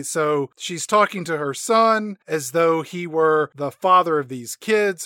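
normal talking voice

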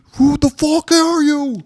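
hammed-up terror at the killer; mock panicked yell. [name] yelling at an invisible attacker, hammed-up panic.

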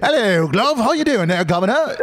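high-pitched, hammed-up Cockney greeting; over-the-top Cockney accent. Shown as a demonstration. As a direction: lay on the Cockney to embarrass a teenager. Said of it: "the most over-the-top Cockney accent".

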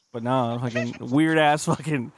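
usual speaking voice

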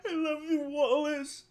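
hammed-up tearful goodbye to the walrus; mock crying. Retelling the movie, the girl cries her love to Wallace. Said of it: "she's crying".

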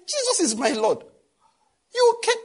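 high, loud exasperated cry; exasperated outcry. Retold or imitated. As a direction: throw up your hands in disbelief. Retold outburst at driver.

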